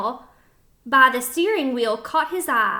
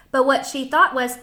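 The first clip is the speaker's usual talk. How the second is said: ordinary delivery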